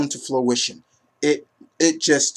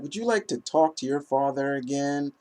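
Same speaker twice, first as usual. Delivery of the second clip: softer, hushed menacing villain quote; lightly voiced creepy taunt; a hint of a quiet sinister villain; eerie villain; 9 dB quieter than usual. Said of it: "It comes off so eerie".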